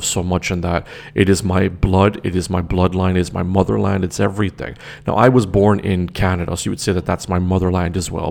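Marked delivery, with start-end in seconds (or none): none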